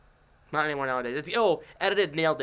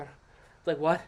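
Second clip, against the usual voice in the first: normal talking voice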